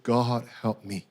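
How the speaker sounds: low, slow, quiet plea; subdued, humble prayer; praying. Retold or imitated. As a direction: softly ask for help in prayer. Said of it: "And so as I prayed".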